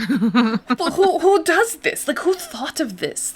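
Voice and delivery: mock German outrage at the pairing; German dismay. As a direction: play the shocked German mother-in-law. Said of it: "the German dismay".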